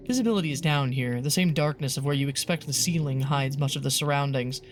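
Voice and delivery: low, even read of grisly horror text; story narration. Acted. Streamer reading grisly game text aloud to viewers, story narration. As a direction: narrate the horror steadily and descriptively.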